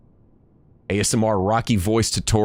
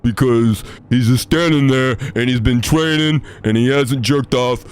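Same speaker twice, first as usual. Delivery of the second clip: Rocky voice; 2 semitones higher than usual. Shown as a demonstration. Streamer demonstrating the Rocky voice in full for chat. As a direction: mumble like a punch-drunk boxer. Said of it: "how to do that".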